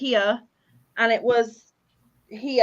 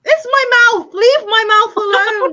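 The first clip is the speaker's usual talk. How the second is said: much higher and louder, hammed-up indignation; mock outrage; 10 semitones higher, 10 dB louder than usual. Teased by her cohost, she protests in hammed-up outrage. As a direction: protest in jest, playing offended.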